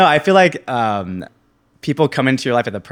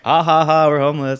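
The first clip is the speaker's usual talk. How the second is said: mock laughing quote; 6 semitones higher, 5 dB louder than usual. To his friend, joking about being homeless, laughing. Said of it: "I was like".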